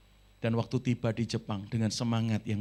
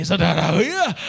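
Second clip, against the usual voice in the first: calling out to congregation; 3 semitones higher, 10 dB louder than usual. Real, not acted. Preacher calls to the congregation.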